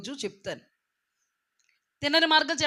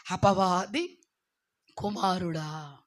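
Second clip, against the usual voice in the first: low, drawn-out denunciation; slow, solemn condemnation; grave rebuke; 5 semitones lower, much slower than usual. Acted. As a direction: drop low and stretch out the accusation. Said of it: "ఏమని మాట్లాడుతున్నారో ఇక్కడ".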